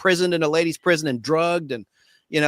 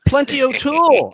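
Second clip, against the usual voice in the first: put-on high, coy Bond girl introduction; 7 semitones higher, 3 dB louder than usual. Host imitating the Bond girl's answer. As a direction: purr the name up high. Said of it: "And he goes".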